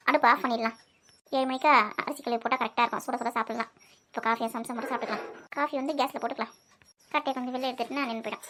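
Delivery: low, very soft self-talk; hushed, low thinking aloud; talking to herself. Acted. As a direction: murmur your chores through to yourself. Mother talking to herself over cooking.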